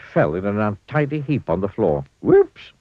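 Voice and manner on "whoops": very high, loud falling whoops; Clumsy's whoops. A narrator's reading. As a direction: squeak it out after dropping the eggs. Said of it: "he said".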